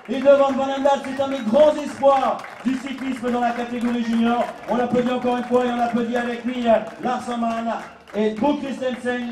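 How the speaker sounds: rousing announcer call to the crowd; podium announcer. Acted. Announcer to podium crowd, praising junior riders in a rousing call. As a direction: sell the riders to the crowd at full voice.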